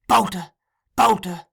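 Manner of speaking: high, loud, falling calls; calling to wake. A narrator's reading. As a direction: call the name to rouse a sleeper.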